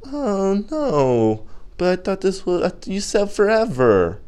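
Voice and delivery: high-pitched pitiful whine; mock heartbroken pleading. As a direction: imitate a dumped teenage boyfriend begging. Acts heartbroken boyfriend pleading.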